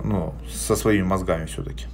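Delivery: ordinary talk, nothing marked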